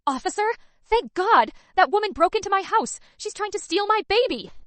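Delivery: high, loud, fast panicked plea; fake distress. A narrator's reading. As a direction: play the frantic victim for the police. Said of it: "tears welled in her eyes".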